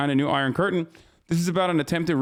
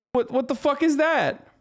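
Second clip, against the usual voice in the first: incredulous; 10 semitones higher than usual. Real, not acted. Ranting about banned Russian cats.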